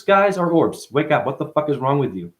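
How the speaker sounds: usual speaking voice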